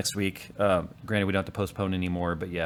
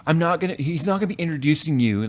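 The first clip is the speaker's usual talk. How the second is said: put-on playful, a bit louder teasing of his eight-year-old; teasing dad; 7 semitones higher, 5 dB louder than usual. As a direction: needle your daughter in fun. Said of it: "like, teasing her".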